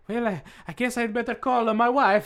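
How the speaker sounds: high, hammed-up Italian voice; Italian accent. Window washer must call his wife late, mock Italian accent. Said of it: "Why is he Italian?".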